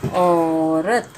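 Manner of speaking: औरत drawn out slowly and evenly; teacher stretching औरत; slow sounding out. Shown as a demonstration. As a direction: say औरत slowly so children hear each sound. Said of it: "बड़े अ की मात्रा, र, त".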